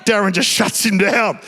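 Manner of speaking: usual speaking voice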